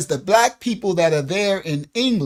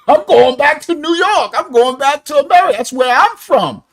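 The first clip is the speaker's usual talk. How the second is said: high, loud, proud declaration; mock defiant boast; 6 semitones higher, 7 dB louder than usual. American interviewer insists loudly he is going home. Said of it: "the brother from America, like".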